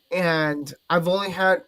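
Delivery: usual speaking voice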